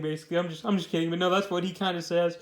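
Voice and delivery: ordinary talk, nothing marked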